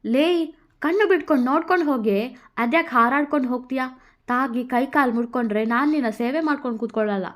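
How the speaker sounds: Krishna scolding. Acted. Krishna scolds Radha for falling, fast and loud. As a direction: snap at her quickly for being careless.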